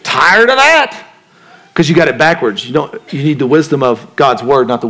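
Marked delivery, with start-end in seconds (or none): 0.0-1.1 slow, higher, lightly voiced weary God. Preacher voicing God as weary of the listener. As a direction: drag it out like God fed up. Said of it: "away from God saying".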